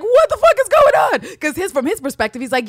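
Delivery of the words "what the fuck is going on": high, loud retold shout of confusion; mock outburst. Van owner yelling at the two women. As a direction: yell it like a baffled guy walking in.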